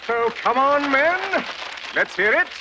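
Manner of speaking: put-on rally them with boyish glee; excited insisting. Explorer shows off the map to Julio and men, excited.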